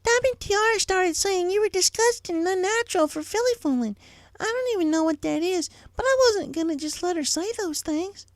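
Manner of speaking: a little filly defending her sister; young filly Apple Bloom. Acted. Apple Bloom telling Applejack about Diamond Tiara, high child voice.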